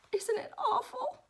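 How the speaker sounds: high, slow, plaintive question; frightened Piglet. Acted. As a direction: draw it out in squeaky dread. Piglet clings, scared of the noise.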